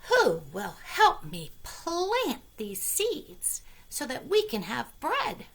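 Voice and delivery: little red hen. Acted. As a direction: ask sweetly for help. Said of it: "She asked her friends".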